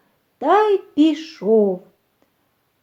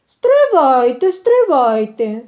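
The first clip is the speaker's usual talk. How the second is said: rooster halting them; 7 dB louder than usual. A narrator's reading. Rooster stops the mice at table, calling loudly. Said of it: "А півник їм і каже".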